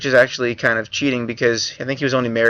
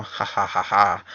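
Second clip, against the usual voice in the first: soft, low mock "ha ha ha", spelled out rather than really laughed; a dry ironic laugh at the treaty; sarcastic laugh; 4 semitones lower, 3 dB quieter, slower than usual. Sarcastic laugh at broken pact.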